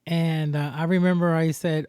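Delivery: ordinary talk, nothing marked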